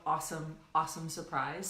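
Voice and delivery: normal talking voice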